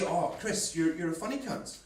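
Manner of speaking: friendly, offhand praise; complimentary tone. Acted. Mate praising Chris as funny, complimentary tone. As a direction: say it as a matey compliment. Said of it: "it can come down to tone as well".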